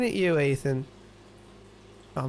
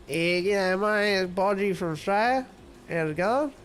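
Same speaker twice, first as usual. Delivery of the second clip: lightly put-on Australian voice, a bit higher; mock Australian accent; 5 semitones higher than usual. Streamer imagining how a viewer talks, mock Australian accent. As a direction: imitate a laid-back Aussie viewer. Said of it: "you talk like".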